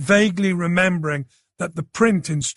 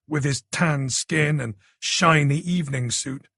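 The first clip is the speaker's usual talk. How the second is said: plain reading voice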